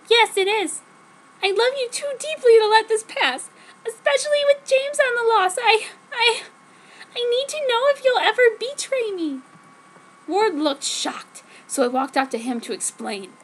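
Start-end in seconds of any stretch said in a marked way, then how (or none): 0.1-9.4 high, louder, overwrought pleading; the lovesick heroine begging to be trusted; breathless melodramatic girlfriend voice; dramatic teen heroine. Acted. Heroine pleads with her love.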